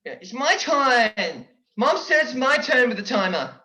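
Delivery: put-on high whining little-kid voice; bratty sibling whine; whiny kid. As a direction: whine like a child claiming a turn. Childish whining over the timer.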